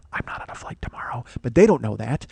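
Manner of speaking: hushed confidential whisper; whispered aside. Real, not acted. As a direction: whisper it behind a hand.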